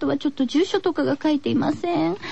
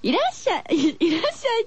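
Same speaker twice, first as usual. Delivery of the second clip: a light, laughing try at the catchphrase; high playful welcome, half giggling; 桂三枝風の「いらっしゃい」を笑いながら; Katsura Sanshi greeting; 6 semitones higher than usual. Retold or imitated. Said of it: "桂三枝さんが".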